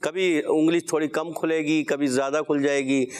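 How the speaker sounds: normal talking voice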